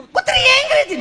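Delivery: hammed-up frightened woman; mock scared lady. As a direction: wail that the dog got angry. Lady complains again.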